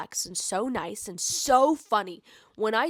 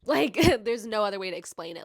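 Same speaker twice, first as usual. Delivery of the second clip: usual speaking voice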